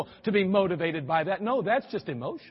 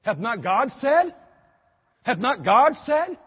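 slow, pointed question rising at the end; lightly acted devil posing a challenge; quoting the devil; 2 semitones higher, 4 dB louder, slower than usual. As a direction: draw out the scripture question. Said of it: "even the devil said".

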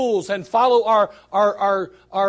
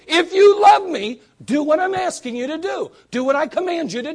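urgent; 6 semitones higher, 5 dB louder than usual. Retold or imitated. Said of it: "He's saying".

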